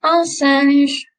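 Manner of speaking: teacher models "Un singe" for pupils to repeat. Shown as a demonstration. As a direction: say "Un singe" for the class to echo, nasal vowel. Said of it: "Répétez, s'il vous plaît".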